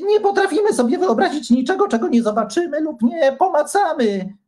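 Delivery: fast, high eager protest. A narrator's reading. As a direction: object quickly and brightly. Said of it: "zaprotestował Antoni".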